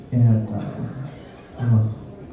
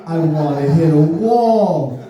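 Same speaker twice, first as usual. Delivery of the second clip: slow drawn-out shallower, then rising much higher; mock lover's plea; 6 semitones higher, slower than usual. Himself mock-pleading to a girl in bed, high. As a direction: beg it slowly, then squeak up high. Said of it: "be like".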